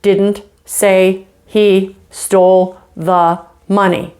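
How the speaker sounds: very slow, evenly spaced words. Shown as a demonstration. Speaker lays out seven words for listeners, word by word. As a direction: set out each word separately with gaps. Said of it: "these seven words".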